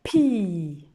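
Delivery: Pi said loud; teacher modelling Pi; syllable demo. Shown as a demonstration. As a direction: say Pi together. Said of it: "On redit ensemble".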